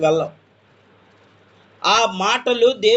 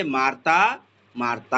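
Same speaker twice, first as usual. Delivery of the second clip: Jesus calling Martha; 8 semitones lower, slower than usual. Acted. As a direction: call her name twice, low and gentle. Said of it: "ఎందుకు రెండుసార్లు పిలుస్తున్నాడు?".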